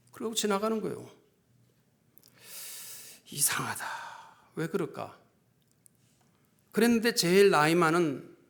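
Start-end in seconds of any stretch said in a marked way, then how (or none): 3.3-5.2 hushed, low talking to oneself; puzzled muttering. Retold or imitated. Pastor puzzles over the congregation's coldness, hushed musing. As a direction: wonder under your breath, soft and low. Retold puzzled thought to himself.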